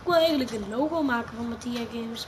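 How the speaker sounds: ordinary talk, nothing marked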